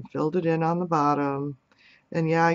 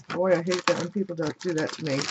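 ordinary talk, nothing marked

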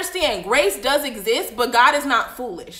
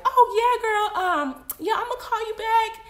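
put-on nervous kid on phone; 8 semitones higher, 4 dB quieter than usual. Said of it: "And you're like".

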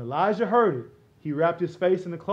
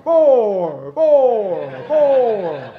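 echo effect; 7 dB louder, much slower than usual. Shown as a demonstration. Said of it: "we want God to talk to us like this".

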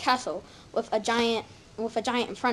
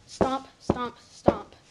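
stomp sound; 2 semitones higher, much slower than usual. Acted. Giant footsteps approaching.

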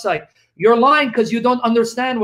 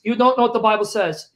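ordinary delivery

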